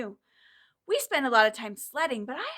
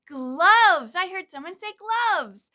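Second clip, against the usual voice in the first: excited praise; 6 semitones higher, 9 dB louder, slower than usual. Acted. Answering the children warmly.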